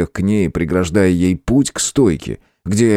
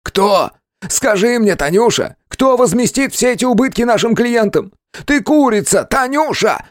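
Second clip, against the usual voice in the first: high, strained furious boss; angry yelling boss; 14 semitones higher than usual. A narrator's reading. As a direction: tear into her angrily. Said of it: "Гневно надрывался".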